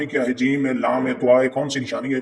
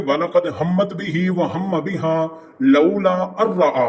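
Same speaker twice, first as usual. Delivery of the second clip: slow recitation; 4 semitones higher, slower than usual. Shown as a demonstration. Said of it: "ये पढ़ेंगे".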